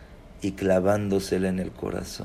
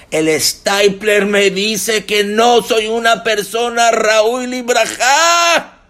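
plea building from raised voice to high, loud wail; mounting desperation, slow and drawn out at the end; acted tearful plea; 6 semitones higher, 8 dB louder than usual. Rejected suitor begging the woman for mercy, acted desperate wail. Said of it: "llegó llorando".

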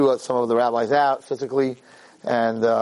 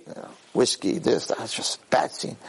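hushed; 3 semitones lower, 4 dB quieter than usual. Real, not acted. Describing teens' drinking scene.

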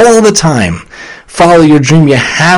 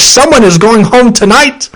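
attempted Gordon Ramsay; 7 semitones higher than usual. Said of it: "I can't do his accent".

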